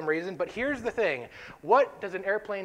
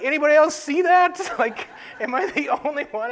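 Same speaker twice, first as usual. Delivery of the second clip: much higher and louder startled question; retold alarm; 10 semitones higher, 10 dB louder than usual. Scared teen looking around the plane, much higher and louder disbelief. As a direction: ask the cabin in disbelief, half laughing. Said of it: "looking around like".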